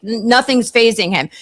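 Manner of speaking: ordinary delivery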